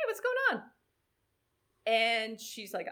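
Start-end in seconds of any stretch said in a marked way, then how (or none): 0.1-0.6 high, light sing-out; gently concerned check-in. Retold or imitated. Coach checking on a panicking swimmer before the race, high friendly check-in. Approaching an anxious athlete on beach.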